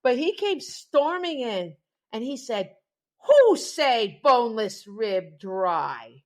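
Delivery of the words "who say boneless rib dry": slow, loud, clipped broken-English demand; mock angry delivery man. Restaurant man storming in, slow loud mock-accented demand.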